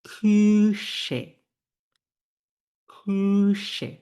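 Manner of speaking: "Küche" with long, high rounded ü, then she; sh-like CH after umlaut. Shown as a demonstration. Teacher demonstrating umlaut rule, "Küche" with held ü. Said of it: "তালব্য শ এর উচ্চারণ করতে হবে".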